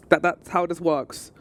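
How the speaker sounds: ordinary talk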